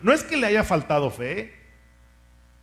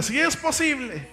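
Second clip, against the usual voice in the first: higher, with a laugh, repeat it stronger; say it louder; 7 semitones higher than usual. Shown as a demonstration. Preacher urging the congregation to repeat louder, with a laugh. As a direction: lift it up for the congregation. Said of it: "Dígalo más fuerte".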